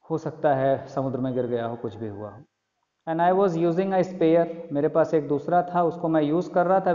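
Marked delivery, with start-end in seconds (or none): 3.1-4.5 slow emphatic reread. Shown as a demonstration. Rereads a line to explain.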